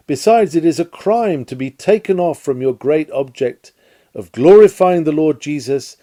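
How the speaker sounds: ordinary talk, nothing marked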